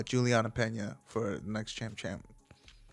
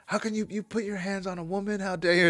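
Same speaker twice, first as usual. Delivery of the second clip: mock indignant girlfriend; 8 semitones higher than usual. Girlfriend scolding boyfriend on stream, mock high indignation. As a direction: play the outraged girlfriend. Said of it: "She's like".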